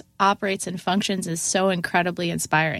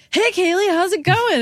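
cheery greeting; 11 semitones higher, 4 dB louder than usual. Retold or imitated. Chuck greets the just-fired narrator.

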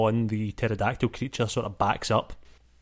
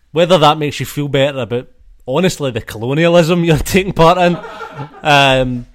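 louder, amused and breaking into laughs; laughing; 5 semitones higher, 9 dB louder than usual. Real, not acted. Game master teasing players about colonialism, laughing through it. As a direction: talk through your own laughter.

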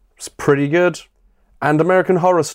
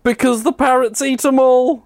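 high punchline; 12 semitones higher than usual. Acted. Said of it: "Parrots ate 'em all".

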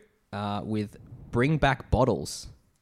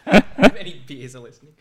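louder, laughing echo of a joke; 2 semitones higher, 11 dB louder than usual. Real, not acted. Podcaster echoing co-host's silly remark, laughing. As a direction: repeat it through laughter.

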